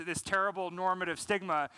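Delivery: normal talking voice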